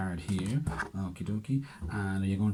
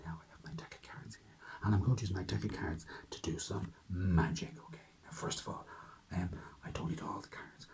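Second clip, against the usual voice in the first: hushed, secretive magician's whisper; magical whisper; 8 dB quieter than usual. Pretend. Magician whispering a greeting to the children, hushed. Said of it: "I'm talking in my magical voice".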